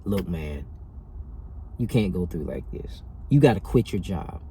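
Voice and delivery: low, soft self-talk; quietly level with yourself; low, sober inner counsel; talking to himself. Retold or imitated. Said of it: "I say".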